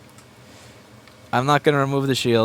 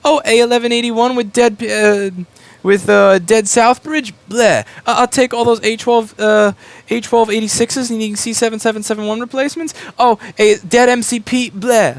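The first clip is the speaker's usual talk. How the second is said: higher, louder, sneering mock of a choosy buyer; a picky e-waste company snubbing junk in a high, loud sneer; snobby picky collector; 8 semitones higher, 5 dB louder than usual. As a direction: turn your nose up at each broken part. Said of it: "try to pick and choose your ass what exactly you wanna take".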